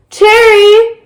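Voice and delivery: high, loud call across the yard. A narrator's reading. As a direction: call her name out loud. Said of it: "Olivia called".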